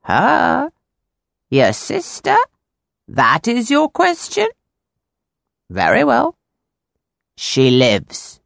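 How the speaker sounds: slow eerie seer; fortune teller. A narrator's reading. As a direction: deliver the prophecy mysteriously. Fortune-teller voiced in story.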